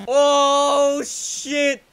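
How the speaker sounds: long drawn-out, loud "oh"; amazed reaction. Real, not acted. Player seeing what the race offers, amazed. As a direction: stretch it out in astonishment. Sees next car reveal.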